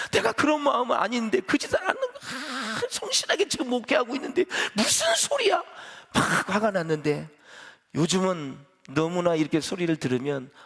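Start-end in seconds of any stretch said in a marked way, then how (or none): 0.1-5.6 mock indignation. Retelling past irritation.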